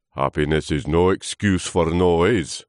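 loud, weary character voice; sleepy old trunk. Acted. Old trunk chiding noisy leaf, sleepy grumble. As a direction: grumble it like a drowsy elder. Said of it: "said the old trunk sleepily".

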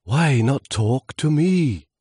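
high, slow, drawn-out gusting voice; voice of the wind. Acted. Wind inviting lonely leaf to talk, slow swooping voice. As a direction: stretch the words out like wind. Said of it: "an unmistakable murmur".

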